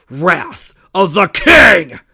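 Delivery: high, slow, booming attack shout; battle shout. Acted. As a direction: roar the attack name. Villain yells attack name.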